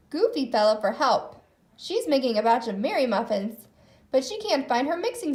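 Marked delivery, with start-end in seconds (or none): none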